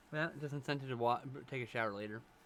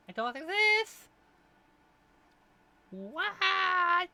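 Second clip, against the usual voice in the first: much higher, slow and louder, a cartoon squeak; squeaky falsetto; 7 dB louder, much slower than usual. Shown as a demonstration. Streamer showing off a silly high voice.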